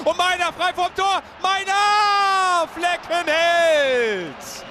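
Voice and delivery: shouted, drawn-out save call; excited play-by-play. Acted. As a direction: yell the chance then the save.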